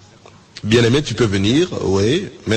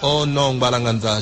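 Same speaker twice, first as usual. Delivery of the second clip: normal talking voice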